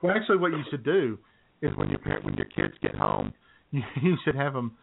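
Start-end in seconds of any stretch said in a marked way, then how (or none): none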